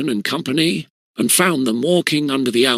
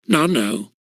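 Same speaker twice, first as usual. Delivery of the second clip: stammering. Acted. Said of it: "stammered Harry Wharton".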